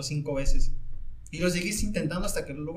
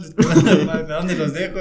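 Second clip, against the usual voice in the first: laughing; 9 dB louder than usual. Real, not acted. Laughs while retelling awkward ride.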